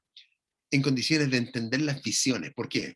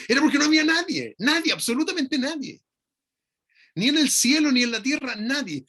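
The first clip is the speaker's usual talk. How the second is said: fervent preaching; 11 semitones higher, 4 dB louder than usual. Real, not acted. Preacher building to emphatic climax.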